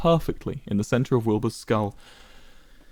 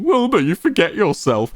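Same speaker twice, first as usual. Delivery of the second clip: higher, sneering mimicry of Quackity; mocking imitation; 8 semitones higher than usual. A narrator's reading. Wilbur mocking Quackity's line back at him, sneering mimicry. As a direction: parrot his words back mockingly. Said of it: "mocking Quackity's intonation".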